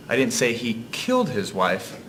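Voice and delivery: "killed" jumps up in pitch, then drops; stress on KILLED. Shown as a demonstration. Narrator showing how inflection changes meaning, stress on "killed". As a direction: punch the word "killed". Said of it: "changing the inflection of the other words".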